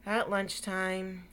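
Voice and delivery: plain reading voice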